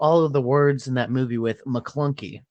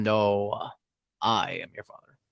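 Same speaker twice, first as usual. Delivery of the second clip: lower, softer Vader impression; Vader quote; 7 semitones lower, 6 dB quieter than usual. Friend quoting Star Wars, in a low Vader voice. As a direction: drop it low like Darth Vader. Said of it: "He says".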